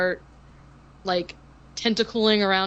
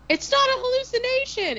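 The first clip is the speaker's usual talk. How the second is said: imitated panic in a very high voice; mock panicked protest. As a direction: squeal it as a panicking film heroine. Acting out Kim's panicked protest.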